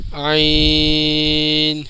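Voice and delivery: عین from the throat, very long, closing on n; final n sounded. Shown as a demonstration. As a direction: stretch عین slowly into its n. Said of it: "اس کا صحیح تلفظ یہ ہے".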